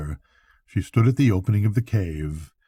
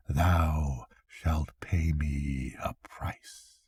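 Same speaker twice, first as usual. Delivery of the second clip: slow, soft unvoiced whisper; 5 semitones lower, 9 dB quieter, much slower than usual. A narrator's reading. The witch whispering her price to the fisherman. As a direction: breathe the threat slowly.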